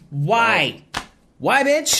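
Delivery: high-pitched indignant demand; incredulous, drawn-up protest; mock exasperation. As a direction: act out a baffled, fed-up viewer, pitch way up. Acts out exasperated viewer's reaction.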